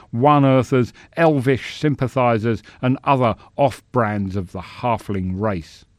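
loud, ringing orator's voice; pompous senator oratory. Acted. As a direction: sneer it out to the chamber. Senator's speech.

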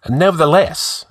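sharp, high impatient retort. Acted. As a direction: cut him off impatiently. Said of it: "answered the outlaw impatiently".

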